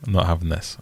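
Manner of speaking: normal talking voice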